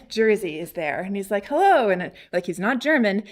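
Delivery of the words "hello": high, loud, cheery hello; friendly greeting. Retold or imitated. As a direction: greet visitors like a welcoming host. Acts smiling man's greeting.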